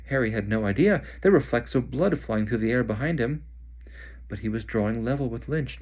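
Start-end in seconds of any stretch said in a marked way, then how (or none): none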